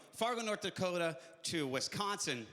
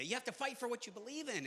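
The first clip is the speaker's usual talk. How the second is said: usual speaking voice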